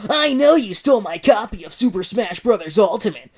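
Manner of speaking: loud angry accusation; accusing Sonic. Acted.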